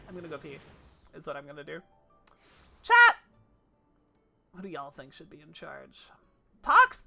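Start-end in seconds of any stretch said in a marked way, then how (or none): 2.8-3.2 shout. Real, not acted. Calling out to chat. 6.6-7.0 shout. Real, not acted. Calling out to chat.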